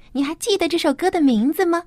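ordinary delivery

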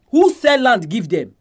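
usual speaking voice

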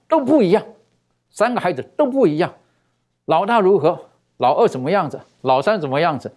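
high, emphatic imitation of a mother; unhesitating reply. A mother answering about her three sons, imitated as an unhesitating reply. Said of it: "这妈妈毫不思索的就讲了".

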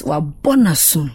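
wa bo na sum spoken clearly as a Twi demonstration; Twi example phrase. Shown as a demonstration. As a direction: say wa bo na sum carefully in Twi, stressing bo as an intensifier. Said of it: "Like, let me use this".

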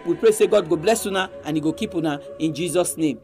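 normal talking voice

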